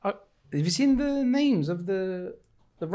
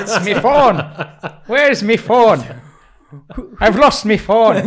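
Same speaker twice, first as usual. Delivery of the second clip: loud, laughing mock Irish, slower at the end; laughing Irish accent impression; hammed-up Irish accent; 3 semitones higher, 9 dB louder, slower than usual. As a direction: parody the friend's impression of you, Irish accent. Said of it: "That is your impression of me".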